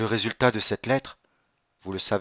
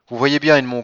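louder, higher cry; 4 semitones higher, 7 dB louder than usual. A narrator's reading. Countess cries out to the count, louder and higher. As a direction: call out to him in alarm. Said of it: "s'écria Mercédès".

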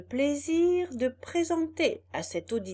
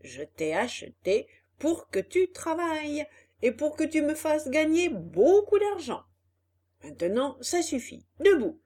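loud, scolding boss; angry boss; 4 semitones higher, 5 dB louder than usual. Acted. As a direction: yell orders at the donkey.

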